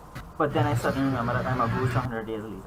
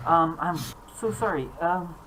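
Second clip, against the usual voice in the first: flustered ghost question; 4 semitones higher than usual. Acted. Ghost asks why she came.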